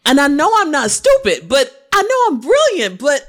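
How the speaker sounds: loud, high-pitched insistence; emphatic protest. Real, not acted. Emphatic protest about her intelligence.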